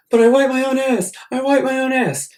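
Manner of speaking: imitating a sobbing kid's high, loud wail; crying kid. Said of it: "and he's crying".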